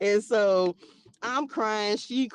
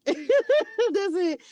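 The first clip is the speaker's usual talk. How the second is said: laughing; 12 semitones higher, 3 dB louder than usual. Real, not acted. Laughs while saying it.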